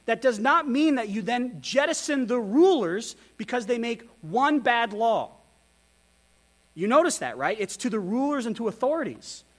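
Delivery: normal talking voice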